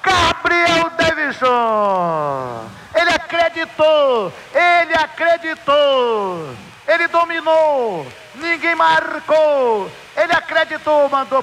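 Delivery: high, stretched goal celebration; explosive goal commentary. Real, not acted. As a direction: shout the scorer's praise high.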